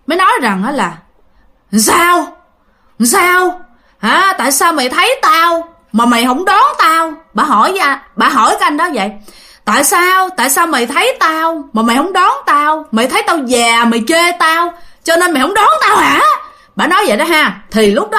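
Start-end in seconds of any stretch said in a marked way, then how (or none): 1.7-7.1 higher, sharp accusing demand; a hag scolding, half mock; angry old woman. Ghost hag demands why the driver passed her, angry. 9.6-16.2 higher, sharp accusing demand; a hag scolding, half mock; angry old woman. Ghost hag demands why the driver passed her, angry.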